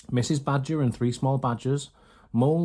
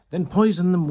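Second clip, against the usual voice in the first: higher, louder irritated command; impatient order; 6 semitones higher, 7 dB louder than usual. A narrator's reading. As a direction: bark it at the cook. Mrs Bean snapping at Mabel.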